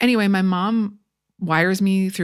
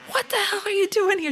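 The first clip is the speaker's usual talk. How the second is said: retold anger, pitched way up; imitating pissed-off mother; 14 semitones higher than usual. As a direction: act out an indignant mother at the door. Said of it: "She was pissed".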